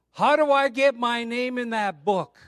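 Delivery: high, loud retold outcry; urgent calling out. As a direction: call it out before the congregation, acting him. Acting man calling out in church.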